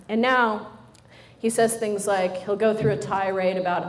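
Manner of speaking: usual speaking voice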